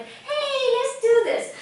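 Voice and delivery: high, loud fake hype; way over-the-top peppy cheer; overhyped cheer. Shown as a demonstration. Teacher mocks fake liveliness with a loud high cheer. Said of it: "what I mean by lively is not like".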